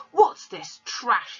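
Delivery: slow, loud, scornful sneer; contemptuous father. A narrator's reading. Father scorning his daughter's book, slow and loud. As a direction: drag the word trash out in disgust. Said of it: "he said, snatching the book".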